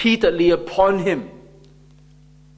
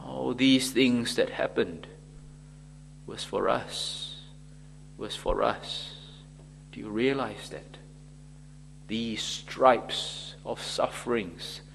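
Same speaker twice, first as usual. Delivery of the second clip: hushed solemnity; 6 semitones lower, 9 dB quieter than usual. Real, not acted. Preacher asks listeners to realize the sufferings, hushed. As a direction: let it sink in, quietly and low.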